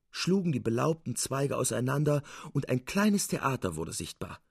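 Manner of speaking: ordinary delivery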